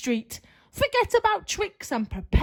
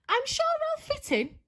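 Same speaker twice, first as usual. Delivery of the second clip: cheery pumpkin; 13 semitones higher than usual. Acted. Pumpkin reassures witch.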